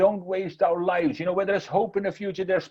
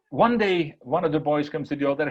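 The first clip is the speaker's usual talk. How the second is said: ordinary talk, nothing marked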